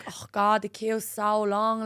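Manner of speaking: put-on slow Irish-accented moan. Waiting in the queue to attract men, put-on Irish accent. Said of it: "I'd put on an Irish accent".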